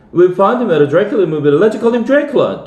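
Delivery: put-on fast, high, eager child's plea; excited child. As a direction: blurt it out like a thrilled kid. Excited child naming the pet.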